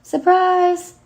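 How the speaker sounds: sarcastic sing-out, high and loud at both ends; mock cheerful announcement. Daughter imitating absent mother showing up at the door, mock cheerful. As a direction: fake breezy surprise, punched at the end. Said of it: "showing up at the door".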